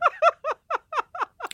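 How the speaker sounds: a high silly creature giggle. A narrator's reading. The creature giggles as Charles apologizes.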